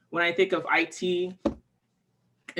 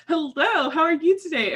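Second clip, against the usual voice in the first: bright, much higher eager hello; overly cheerful greeting; 9 semitones higher, 3 dB louder than usual. Retold or imitated. Greeting the German counter clerk, mock overeager and higher. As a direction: gush like a dumb happy tourist. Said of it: "I'm just like".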